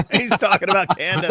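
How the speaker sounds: high-pitched burst of laughter into words; laughing. Real, not acted. Co-host reacting to the clip about Candace, laughing. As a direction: talk through a real laugh.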